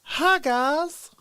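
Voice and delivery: high put-on voice, a different character; How Dare You guy impression. Streamer showing chat his favourite meme character, high put-on voice. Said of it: "I'm really into How Dare You guy".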